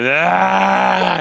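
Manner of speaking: long, high, loud mock scream; sustained imitation scream; imitated scream. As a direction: hold out a high "aah" as if straining to reach someone. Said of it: "they're both just screaming".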